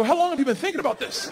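mock astonishment; amazed. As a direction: caught off guard by a little schemer. Dad amazed at daughter's scheme.

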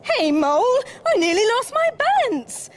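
loud shout climbing high; indignant yell. A narrator's reading. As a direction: yell a protest as a startled kangaroo. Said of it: "yelled Kangaroo".

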